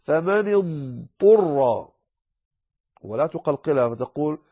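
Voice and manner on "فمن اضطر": فمن اضطر slow with a long dad; correct dad elongation. Shown as a demonstration. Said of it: "لكنك تلفظها بالاستطالة".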